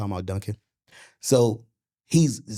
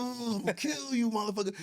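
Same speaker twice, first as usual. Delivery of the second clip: mock tough threat; 10 semitones higher, 5 dB quieter than usual. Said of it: "You're like".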